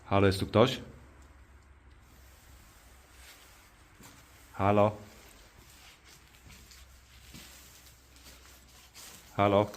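loud call into the empty church. Real, not acted. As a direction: call out to see if anyone answers.

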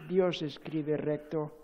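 ordinary talk, nothing marked

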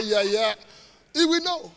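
usual speaking voice

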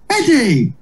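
high called name; calling out. Retold or imitated. Ben arriving and calling Eddie, high call. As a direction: call across the room. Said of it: "And he went".

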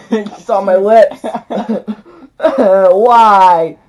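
loud pained laughter; laughing in pain. Real, not acted. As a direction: laugh it out while it burns. Pepper burning her lips.

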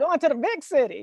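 high, laughing Southern twang, quick; Southern accent. Retold or imitated. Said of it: "in my Southern accent".

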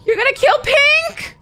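real stunned gasp; shocked gasp. Real, not acted. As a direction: gasp hard then ask in shock. Shocked at planned kill.

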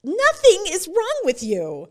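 friend's loud, tender insistence; emphatic reassurance. Retold or imitated. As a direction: insist firmly, with love. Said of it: "And she said".

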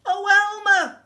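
high, loud pleading call; desperate high holler. A narrator's reading. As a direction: shout after someone walking away. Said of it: "called Wilbur".

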